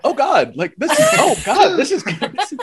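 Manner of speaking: high, laughing replay of being caught off guard; retold shocked surprise. Host replays his shock at the kids appearing, high and laughing. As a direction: squeal it like the twist just hit you. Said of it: "and I went".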